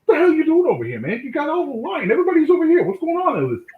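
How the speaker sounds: high, loud, amazed mock outrage; Billy's teasing. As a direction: tease him like a jealous friend. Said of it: "he goes".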